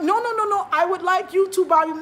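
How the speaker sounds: hammed-up auntie demanding; insistent auntie. Imitating the auntie pushing her to buy bananas, high and loud.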